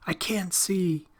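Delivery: put-on frightened boy losing his sight; panicked cry. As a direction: plead in panic, high. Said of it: "The boy's squealing voice".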